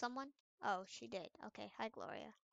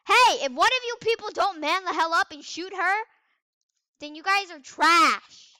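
loud higher command, slowing at the end; bossy yell; 6 semitones higher, 13 dB louder than usual. Real, not acted. Gamer scolding teammates, bossy loud yell. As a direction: order the team around loudly.

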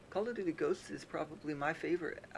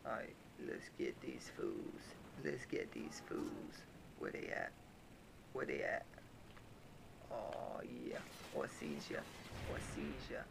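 much lower, quiet growly thug voice; deep tough guy; 14 semitones lower, 7 dB quieter than usual. Pretend. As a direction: stalk them like a gruff backwoods hunter. Deep tough-guy voice hunting fools.

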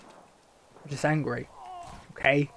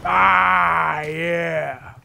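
loud triumphant yell; victory yell; 2 semitones lower, 7 dB louder than usual. Real, not acted. Gamer winning a fight, loud triumphant cheer.

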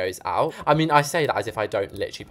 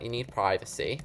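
normal talking voice

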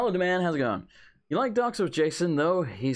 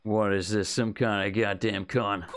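lower, gruff suspicious player character; suspicious tough guy; 7 semitones lower than usual. Acted. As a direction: growl it like a wary thug.

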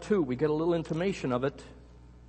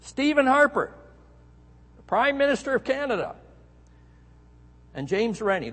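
higher, louder official announcement; mock official proclamation, higher and louder; formal invitation; 8 semitones higher, 4 dB louder than usual. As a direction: read it out like a grand state invitation. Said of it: "it read this way".

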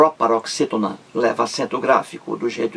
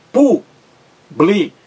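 "Pu-bli-cu" in three separate slow beats, high "Pu"; syllable by syllable; 6 semitones higher, 4 dB louder, much slower than usual. Shown as a demonstration. Teacher counting three syllables, slow separated "Pu-bli-cu". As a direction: break "Pu-bli-cu" apart with clear pauses. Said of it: "três sílabas".

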